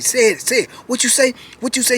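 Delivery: goading him to fight, played up; retold taunt. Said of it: "He said".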